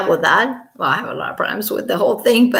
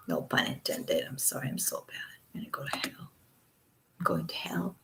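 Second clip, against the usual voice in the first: hushed, much softer guilty whisper; 4 semitones lower, 14 dB quieter than usual. Real, not acted. Apologizing to viewers for a bad pun, whispered.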